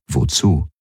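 plain reading voice

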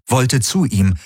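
ordinary delivery

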